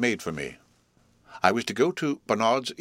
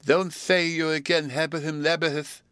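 higher, lisping pleading voice; dismayed suitor; 8 semitones higher than usual. Acted. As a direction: beg in disbelief.

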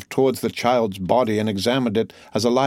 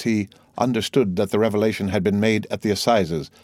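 ordinary delivery

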